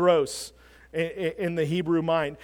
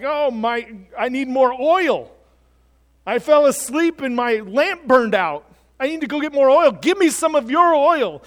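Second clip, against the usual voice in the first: higher, louder, flustered bridesmaid voice; acted panicked pleading; 6 semitones higher, 6 dB louder than usual. Unprepared bridesmaids as the bridegroom shows up, acted fluster. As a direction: imitate someone caught unprepared, begging urgently.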